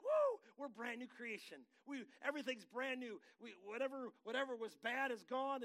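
hammed-up joy of being brand new; mock excited new convert. Preacher mimics newly saved believers, hammed-up excitement.

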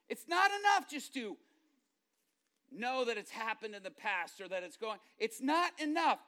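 loud, high, impassioned sermon; fired-up preacher driving the point home; full-force preaching. Real, not acted.

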